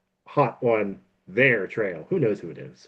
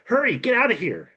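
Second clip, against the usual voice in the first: high-pitched urgent call, not shouted; pressing, high rescue call; urgent warning; 11 semitones higher than usual. Acted. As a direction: hurry them out in a raised pitch. Game master voices urgent rescuer.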